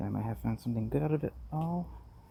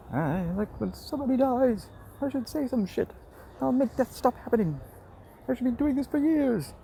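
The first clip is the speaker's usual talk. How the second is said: Madeline Kahn; 9 semitones higher than usual. Acted. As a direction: belt it like a theatrical diva. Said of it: "call it my Madeline Kahn".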